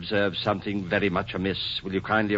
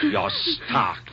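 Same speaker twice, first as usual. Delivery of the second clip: furious outburst. Acted. Husband accusing his wife, furious. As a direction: pitch leaps up on stark.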